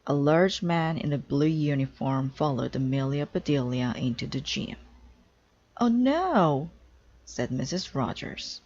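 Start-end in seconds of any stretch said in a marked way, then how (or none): 5.8-6.7 dismayed. Acted. Missus Rogers dismayed.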